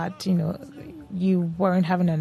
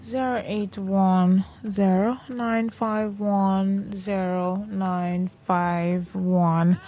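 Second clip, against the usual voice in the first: slow dictation; much slower than usual. Real, not acted. Reading out phone number.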